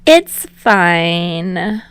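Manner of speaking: through a yawn; lazy yawned words. A narrator's reading. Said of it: "says with a yawn".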